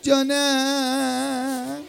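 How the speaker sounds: very drawn-out answer; exaggerated sympathy. Teacher answering wailing pupil, hammed-up drawl. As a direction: stretch it right out to match the wail.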